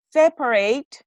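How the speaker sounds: "separate" said syllable by syllable; careful pronunciation. Shown as a demonstration. Teacher correcting Maria's word, careful pronunciation. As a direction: pronounce "separate" carefully in full. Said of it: "I need to emphasize it".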